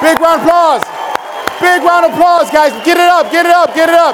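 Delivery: high, insistent hype shouting; rousing crowd call. Real, not acted. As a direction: drive the crowd to cheer harder.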